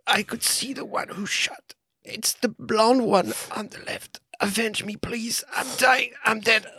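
high, hammy dying bird voice; overacted death scene. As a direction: gasp out your last words, overdoing it. Dying bird's last words.

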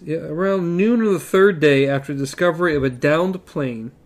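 ordinary delivery, nothing marked

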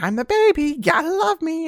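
put-on cheeky high baby taunt; Baby Sinclair voice. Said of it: "he's like".

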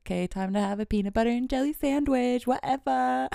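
high, quick sing-out; mock self-persuasion. Podcaster talking themselves into a sandwich, high and flippant. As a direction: toss it off fast and high, rising. Acts out convincing self to eat.

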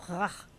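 "ah" opened with a raspy back-of-the-throat Spanish J; rough, throaty breath into "ah". Shown as a demonstration. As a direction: exaggerate the rasp before "ah". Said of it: "have to say it like as an act".

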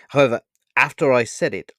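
ordinary delivery

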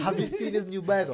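repeating it loud while laughing at the teasing; laughing insist. Real, not acted.

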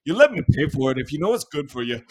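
loud, fast mock Irish threat; gangster Irish. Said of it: "in the most gangster Irish way".

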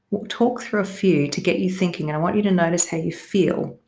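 ordinary talk

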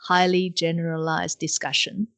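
ordinary talk

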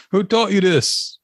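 high, drawn-out mock demand; commercial dad. Trying to be the guy in the commercial, mock-stern dad. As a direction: put on the indignant PSA father. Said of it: "trying to be the guy in the commercial".

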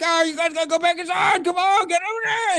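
frantically herding people back inside, high-pitched; urgent, high strained holler; frantic John McClane. Retold or imitated. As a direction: order the crowd back in a panic. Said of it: "And John McClane's like".

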